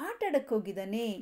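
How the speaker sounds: usual speaking voice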